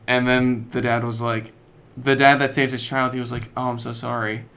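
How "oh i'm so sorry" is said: ordinary talk, nothing marked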